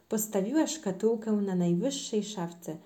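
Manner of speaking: usual speaking voice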